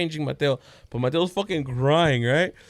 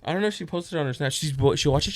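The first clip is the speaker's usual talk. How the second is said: ordinary delivery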